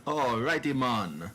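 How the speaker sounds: put-on high lilting Jamaican accent. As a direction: bounce it like a Jamaican voice. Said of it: "in a Jamaican accent".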